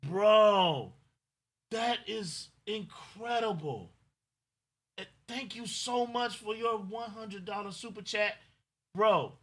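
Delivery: high, slow, moved voice of thanks; overwhelmed thanks. Real, not acted. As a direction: thank him as it sinks in slowly. Thanking a big donor, overwhelmed.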